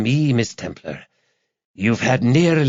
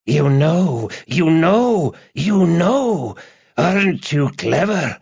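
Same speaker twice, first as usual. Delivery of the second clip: high, gloating madman on the phone; crazed joy and terror; 7 semitones higher, slower than usual. A narrator's reading. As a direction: taunt her in a high, deranged voice. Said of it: "his voice a mixture of joy and terror".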